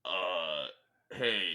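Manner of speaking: ordinary delivery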